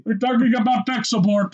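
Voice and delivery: high, put-on tech-support caller; exaggerated high call-center patter; mock telemarketer. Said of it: "telemarketers calling you".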